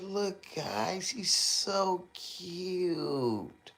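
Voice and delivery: high, very slow cooing; lightly voiced cooing parent; mock doting dad. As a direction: drag it out like a soppy father. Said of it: "He said".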